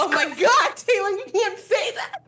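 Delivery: high shocked pearl-clutching cry; scandalized shout. Retold or imitated. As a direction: shriek in scandalized disbelief. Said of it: "everyone's gonna be like".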